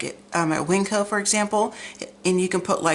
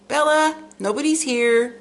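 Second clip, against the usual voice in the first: calling the dog; 8 semitones higher than usual. Real, not acted.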